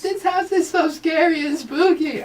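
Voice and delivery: high, slow mock fright; mock scared. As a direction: squeal like a pretend scaredy-cat. Mocking fear of warehouse.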